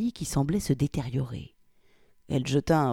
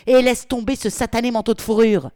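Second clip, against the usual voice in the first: loud, higher shout; angry shout; 7 semitones higher, 9 dB louder than usual. Acted. Polly shouts at Victoria to drop the coat. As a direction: yell the order.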